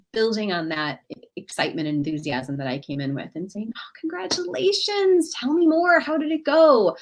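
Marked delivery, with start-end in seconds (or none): 3.8-7.0 high, eager, interested; warmly curious, asking for more; joy cultivator. Pretend. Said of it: "she could be a joy cultivator, showing authentic interest".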